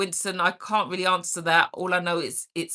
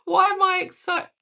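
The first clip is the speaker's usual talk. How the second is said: much higher, puzzled reread of the word excited; 11 semitones higher than usual. Real, not acted. Rereading a viewer's comment about school, higher and puzzled. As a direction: read the comment back in amused surprise. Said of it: "you're not saying excited, are you?".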